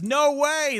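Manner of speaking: high, loud "no way"; shocked at a three-minute ad; surprised. Real, not acted. Streamer reacts to an ad break, shocked.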